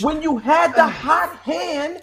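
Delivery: high, slow, shouted hype call; mock hype shout. Cohost hypes the running back in a big mock shout. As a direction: ham up a big announcer call.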